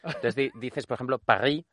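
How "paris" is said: "Paris" a la francesa, sin la ese final; acento francés. Shown as a demonstration. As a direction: di "Paris" con acento francés, comiéndote la última consonante. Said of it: "truco para simular que sabes francés". ("Paris" con erre francesa)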